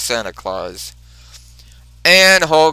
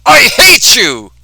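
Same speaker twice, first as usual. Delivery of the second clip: high, loud hateful shout as Rhino; furious high yell; angry yell; 9 semitones higher, 9 dB louder than usual. Retold or imitated. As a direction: bellow it at the enemy. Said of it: "Rhino says".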